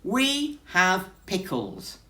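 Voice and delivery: slow emphatic bear; firm bear. Acted. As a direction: insist proudly on pickles. Bear insists on pickles.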